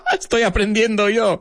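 high-pitched, laughing through the words. Real, not acted. Teacher amused to be learning himself, laughing. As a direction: crack up while admitting it. Laughing at own new discovery.

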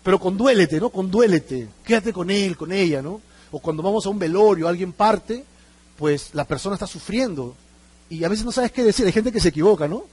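ordinary talk, nothing marked

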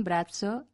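z of brazo said as ts; old Castilian brazo. Shown as a demonstration. Brazo as it was pronounced, T and S as one sound. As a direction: put a t before the s in brazo. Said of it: "Se pronunciaban brazo".

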